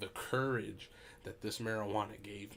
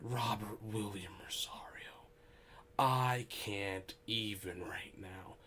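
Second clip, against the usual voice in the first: slow, drawn-out whispered scolding; mock-appalled whisper; slower than usual. Friend using his buddy's whole name, slow whispered dismay.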